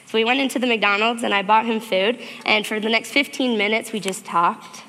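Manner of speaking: ordinary delivery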